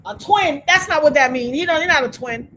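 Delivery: loud, high, exasperated correcting; exasperated people correcting a naive guess; mock exasperation. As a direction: scold like people correcting her. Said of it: "Everybody else is like".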